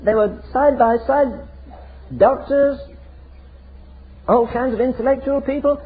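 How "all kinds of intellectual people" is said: ordinary delivery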